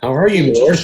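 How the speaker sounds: excited greeting. Acted. Player greets god excitedly.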